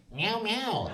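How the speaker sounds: small spoken cat meow; mock meow. Joking about how dogs bark in Korean, mock meow. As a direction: imitate a cat in place of a dog. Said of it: "how the dogs bark in Korean".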